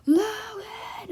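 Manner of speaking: high, loud yell of a name; imitated yell. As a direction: bellow the name like the angry teacher. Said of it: "as loud as she physically could".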